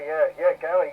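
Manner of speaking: ordinary talk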